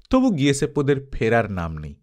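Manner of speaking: plain reading voice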